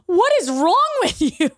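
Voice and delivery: high, incredulous protest; retold outrage. Teller confronting someone who faked crying, high incredulous outrage. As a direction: let the pitch leap in disbelief.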